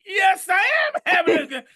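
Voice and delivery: high loud happy yell; joyful shout. Pretend. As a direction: shout your good mood back. Shouting back at negative voice.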